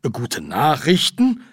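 stretched, incredulous query; disbelieving. Acted. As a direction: echo it as if you can't believe it. Repeats the news in disbelief.

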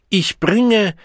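very high, loud proclamation; proclaiming cry. A narrator's reading. The Savage turns to the Deltas, proclaiming loudly. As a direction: call out over the crowd. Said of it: "rief der Wilde".

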